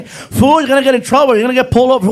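high, loud nagging backseat friend; Felipe yelling. Retold or imitated. Friend warning reckless driver, high loud yelling. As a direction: yell warnings as the worried buddy. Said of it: "my friend Felipe is yelling at me".

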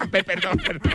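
high laughing sorry; laughing apology. Real, not acted. Laughing after joke. (disculpa entre risas)

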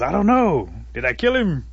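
high, loud questioning holler; acted bewildered shout; puzzled call. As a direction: call up cluelessly to a friend. Turtle calls up, puzzled.

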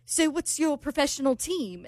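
put-on amateur British accent, asking. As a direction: imitate a British man's question. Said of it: "[name]'s doing with the accent".

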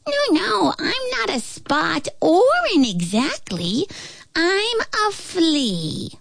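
high squeaky tiny voice; flea voice. A narrator's reading.

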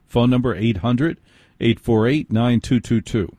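usual speaking voice

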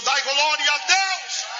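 rallying cry. Real, not acted. Reporter calls church to praise, proclaiming. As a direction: stretch it out to rouse the congregation.

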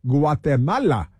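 "Guatemala" as gwah-teh-MAH-lah, mock Spanish accent. Radio host mocks Democrats, saying "Guatemala" in mock Spanish accent. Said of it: "You have to say it with an accent".

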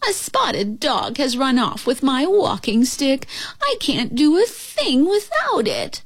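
put-on high, slow, pitiful old witch. A narrator's reading. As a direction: moan the complaint slowly in a high voice.

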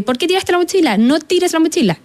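mild mock scold, voice jumping up on the second line; scolding the child. Mother scolding her son for throwing his backpack, lightly mocked. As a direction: play the nagging parent, half in jest. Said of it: "en vez de decirle como".

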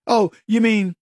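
ordinary delivery

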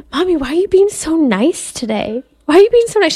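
put-on high, puzzled child asking Mommy; five-year-old child. Voicing five-year-old's repeated question.

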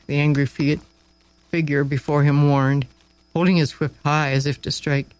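ordinary delivery

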